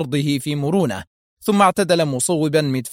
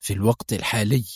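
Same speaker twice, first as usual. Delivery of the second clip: mild angry aside; 3 semitones lower, 3 dB quieter, faster than usual. A narrator's reading. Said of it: "ثم أضاف غاضبا".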